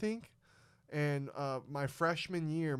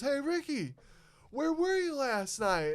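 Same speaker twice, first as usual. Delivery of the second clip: much higher and slow, sing-song coach; coach's voice; 11 semitones higher, 4 dB louder, slower than usual. Retold or imitated. Coach asking the kid why he skipped, high and slow. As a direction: draw it out high like a teasing coach. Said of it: "He goes".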